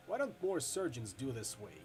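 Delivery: normal talking voice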